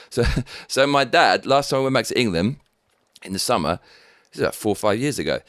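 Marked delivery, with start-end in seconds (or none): none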